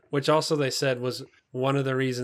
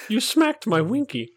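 high, louder playful movie-line impression; quoting a movie kid; 9 semitones higher, 6 dB louder than usual. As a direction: toss off the kid's line in a squeaky whine.

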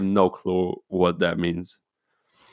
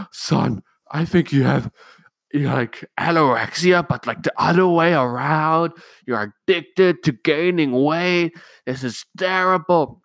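higher, fussing mock mother voice; mocking mom; 8 semitones higher than usual. Mom telling son he's addicted to gaining weight, mock worried. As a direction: lampoon a worried mom's diagnosis. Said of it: "And my mom's like".

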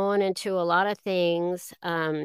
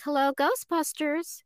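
higher, plain phone greeting, no New York accent; audition receptionist voice; 8 semitones higher than usual. Shown as a demonstration. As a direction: answer sweetly like an ordinary receptionist. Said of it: "sort of like that".

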